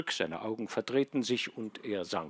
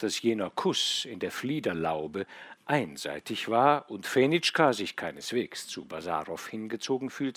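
plain reading voice

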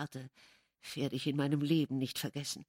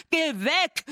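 much higher, louder cry of go away; shouting; 11 semitones higher, 7 dB louder than usual. A narrator's reading. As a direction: yell at the ghost in panic. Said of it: "rief ich".

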